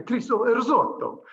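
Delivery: hammed-up Italian accent. Shown as a demonstration. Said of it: "Italian and Italian accent".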